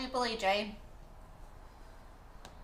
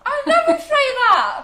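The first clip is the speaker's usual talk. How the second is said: high, loud and slow denial; indignant protest; 17 dB louder, slower than usual. Real, not acted. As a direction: shriek it out in mock outrage. Protesting a teasing imitation.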